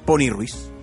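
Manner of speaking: ordinary talk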